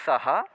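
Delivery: सः with dental स; plain dental s. Shown as a demonstration. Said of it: "दोनों के उच्चारण में भेद है". (दंत्य स वाला सः)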